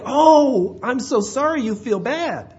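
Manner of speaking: high, drawn-out hollow pity; exaggerated, ineffectual commiseration; mock sympathy. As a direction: coo hammed-up sympathy from the sidelines. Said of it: "He doesn't just sit there and say".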